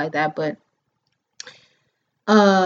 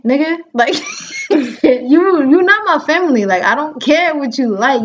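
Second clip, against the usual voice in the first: higher and louder, laughing indignant retort; retold heated comeback; 7 semitones higher, 5 dB louder than usual. Snapping back at the man who wants her with a man, loud. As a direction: push back hard, half laughing.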